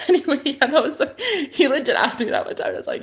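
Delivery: chuckling through the words; laughing. Real, not acted. Laughing after mocking her boyfriend.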